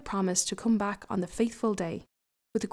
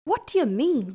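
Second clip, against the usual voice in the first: plain reading voice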